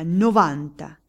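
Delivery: a cannon-loud call of the number; lottery crier booming the last number; booming shout. A narrator's reading. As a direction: blast it across the square. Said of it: "con voce da cannone".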